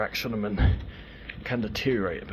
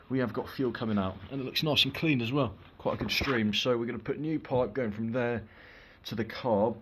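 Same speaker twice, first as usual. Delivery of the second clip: ordinary talk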